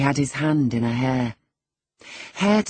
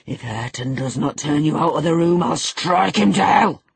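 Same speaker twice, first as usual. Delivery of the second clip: thundering; 2 semitones higher, 5 dB louder than usual. A narrator's reading. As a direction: build to a thunderous threat. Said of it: "thundered Heathcliff".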